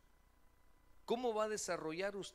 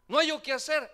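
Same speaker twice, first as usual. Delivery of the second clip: high, loud helpless outcry; mock desperate wail; 11 semitones higher, 16 dB louder than usual. Believer complaining to God, loud high wail. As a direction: wail it like there's no way out.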